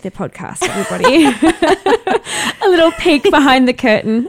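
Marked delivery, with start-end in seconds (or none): 1.3-2.6 high burst of laughter; laughing. Real, not acted. Laugh at editing confession.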